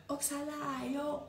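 sing-song taunt; mocking. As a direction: stretch it out to tease. Mocking drawn-out repeat.

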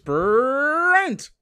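Tony the Tiger entrance. Retold or imitated. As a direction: copy the cereal mascot call. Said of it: "gave him the Tony the Tiger entrance".

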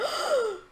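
sharp, high gasp cutting speech off; shocked gasp. Real, not acted. Player spotting a temple, shocked gasp. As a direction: gasp mid-sentence at a sudden sight. Spots a desert temple mid-sentence.

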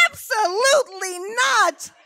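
hammed-up mother's shout of refusal; loud enough for Peoria. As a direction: holler it so the next town hears. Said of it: "people in Peoria probably heard me".

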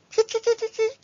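high squeaky monkey chee; monkey chatter. Acted.

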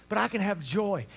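normal talking voice

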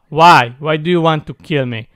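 normal talking voice

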